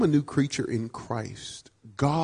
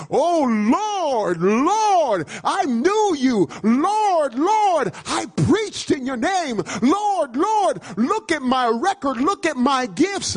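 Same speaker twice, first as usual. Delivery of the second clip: high, insistent cries of Lord; emphatic pleading claimant; 12 semitones higher, 5 dB louder, much slower than usual. Acted. False believer pleading his record to Jesus, high emphatic pleading. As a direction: plead your record in a raised, urgent voice. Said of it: "emphatically claims me as Lord".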